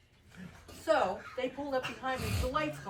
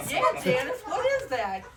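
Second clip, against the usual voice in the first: mock incredulous; 4 semitones higher, 6 dB louder than usual. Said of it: "I'm like".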